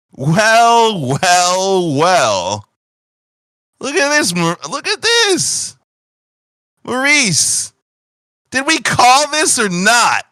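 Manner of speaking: high, loud, gloating officer voice; smug officer. Acted. Union officer gloating to his partner, high and smug. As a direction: gloat over the catch. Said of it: "and you hear".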